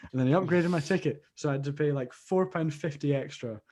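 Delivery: ordinary delivery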